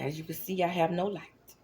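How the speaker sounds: ordinary talk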